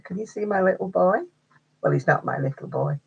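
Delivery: ordinary delivery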